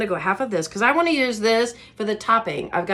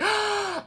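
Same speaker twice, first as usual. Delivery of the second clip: sharp high mock gasp; imitated gasp; 11 semitones higher, 4 dB quieter than usual. Imitating a viewer's thrilled gasp. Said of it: "you're like".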